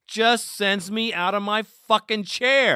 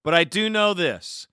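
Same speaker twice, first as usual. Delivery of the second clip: usual speaking voice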